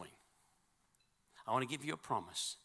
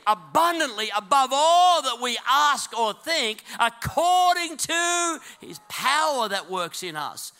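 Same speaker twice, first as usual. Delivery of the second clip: high, loud preacher exhorting the crowd; full-force preaching; 12 semitones higher, 15 dB louder, slower than usual. Real, not acted. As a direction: drive it home in a raised, ringing voice.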